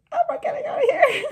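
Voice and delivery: high, fast, giggly dread; high squeaky laughing panic. Retold or imitated. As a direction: laugh it out in mock alarm. Retelling her panic in the dungeon.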